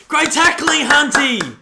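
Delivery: yelled high and loud, cheering the tackle; excited shout. Real, not acted. Fan roaring at the TV over a great tackle, loud shout. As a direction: roar the praise like a fan in the stands.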